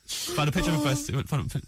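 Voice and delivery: normal talking voice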